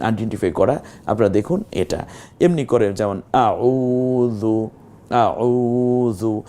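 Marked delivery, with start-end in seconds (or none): 3.3-6.4 আউযু very slowly, আউ held long. Shown as a demonstration. Quran teacher showing students the lengthened আউ. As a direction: draw out আউ in আউযু. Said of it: "এই যে আউ পড়লাম কেন?".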